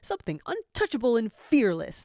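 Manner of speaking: father proudly naming his pup, loud; happy shout. A narrator's reading. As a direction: shout it out gladly. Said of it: "the father shouts happily".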